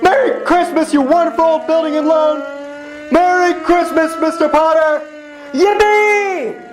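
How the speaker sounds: high, exultant greetings called out; joyful shouting. Acted. George runs through town shouting Merry Christmas. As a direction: shout your joy to the whole town.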